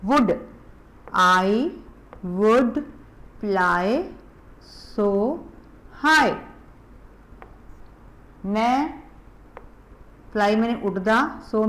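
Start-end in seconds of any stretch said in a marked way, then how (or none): 1.2-6.3 word by word with long pauses for repeating; slow model reading. Shown as a demonstration. Teacher reading a poem line for children, slow and separated. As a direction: read each word slowly for kids to echo.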